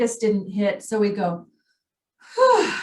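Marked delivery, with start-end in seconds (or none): 2.3-2.7 a high, loud sigh of relief; relieved whew. Pretend. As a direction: blow out a big pretend whew of relief. Relief after near car accident.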